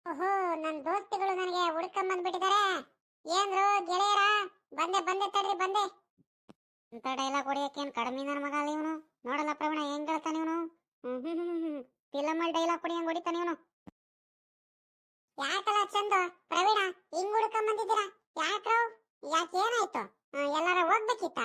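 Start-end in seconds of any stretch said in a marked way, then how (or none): 0.1-5.8 put-on higher boyish voice, film-dialogue flourish; grandly playful boy Sunila. Sunila answers friends who came looking for him, theatrical. 15.4-21.4 put-on higher boyish voice, feigned puzzlement; boy Sunila playing innocent. Sunila asks friends why they came, playing innocent.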